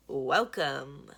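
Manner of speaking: loud, big booming greeting; booming welcome. Acted. Villain greeting the kids from the top of the stairs, booming. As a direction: boom it down from the stairs like a showman. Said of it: "Blue's voice booms from above".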